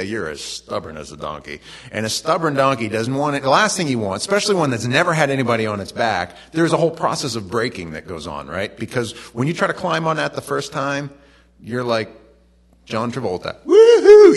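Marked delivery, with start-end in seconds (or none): 0.0-1.6 low, softer saying of the idiom; stubborn as a donkey. Retold or imitated. Preacher quietly gives the saying people really use about donkeys. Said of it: "We say". 13.6-14.4 big high whoop like a cowboy; excited whoop. Retold or imitated. Preacher mimics a rider on a horse with a wild whoop. Mimicking John Travolta riding.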